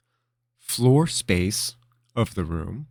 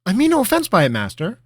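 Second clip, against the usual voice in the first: quick and anxious; 14 semitones higher, 8 dB louder than usual. Acted. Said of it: "Ravenel said quickly".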